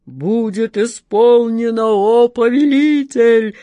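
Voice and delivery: громко, медленно, гулко; загрохотать как великан; грохочущий джинн. Acted. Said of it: "загрохотал Джин". (громогласный бас джинна)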